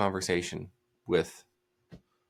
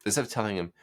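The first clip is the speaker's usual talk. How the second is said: usual speaking voice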